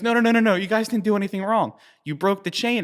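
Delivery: eager reassurance. Retold or imitated. Founder reassures the crew.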